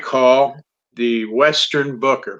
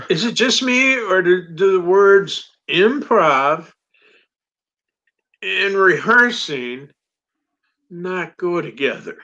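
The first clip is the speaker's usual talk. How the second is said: mock incredulous; 6 semitones higher than usual. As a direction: stretch it out like it's obvious nonsense. Sarcastic retort to club staff.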